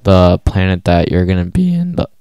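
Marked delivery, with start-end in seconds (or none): 1.4-2.1 voice cracking. Real, not acted. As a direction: voice cracks mid-sentence. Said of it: "Sorry for my voice crack".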